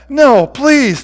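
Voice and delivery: high, loud desperate plea; begging. A narrator's reading. Former rebel begs for the blows to end, desperate. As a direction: beg with the pitch thrown right up. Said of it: "the former rebel begs".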